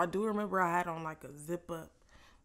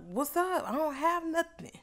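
defiant; 9 semitones higher, 4 dB louder than usual. Retold or imitated. Defiant challenge to the searcher.

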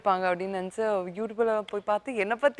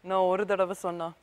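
swaggering movie one liner; 5 semitones lower than usual. Pretend. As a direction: deliver it like a Rajini punch line. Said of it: "Baasha punch dialogue இப்ப நீங்க சொல்லணும்".

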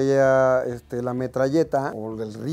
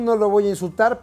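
usual speaking voice

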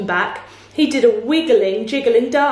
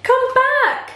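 high-pitched calling out; storybook shout; 13 semitones higher than usual. A narrator's reading. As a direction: call after them, very high. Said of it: "he shouted".

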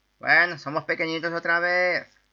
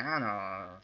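ordinary delivery

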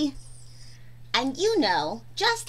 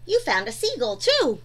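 usual speaking voice

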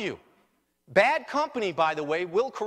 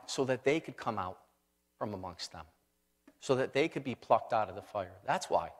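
hushed emphasis; 9 semitones lower, 5 dB quieter than usual. Real, not acted. Hushed answer to his question.

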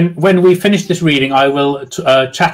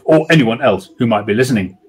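ordinary delivery

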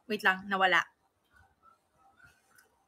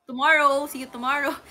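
normal talking voice

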